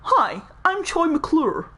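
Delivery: hammed-up showbiz introduction, drawn out; Troy McClure. Washed-up actor introducing himself to viewers, showbiz presenter voice. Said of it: "You do have a lot of voices".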